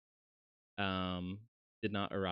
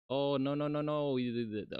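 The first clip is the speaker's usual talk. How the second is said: startled, higher and louder protest; surprised no; 6 semitones higher, 4 dB louder than usual. Real, not acted. Vtuber reacting to own model, startled. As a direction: caught off guard, blurting no.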